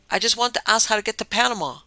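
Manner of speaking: high, loud call after a fleeing woman; shouting. Acted. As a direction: shout it into the bushes so she hears. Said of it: "he shouted".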